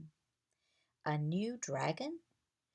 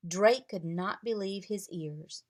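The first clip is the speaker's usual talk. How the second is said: plain reading voice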